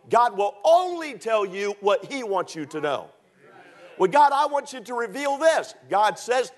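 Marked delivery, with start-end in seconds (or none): 4.0-5.7 high-pitched mock insistence; raised, imitated demand; demanding plea to God. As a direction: act out a believer pressing God for answers. Voices a believer demanding answers from God.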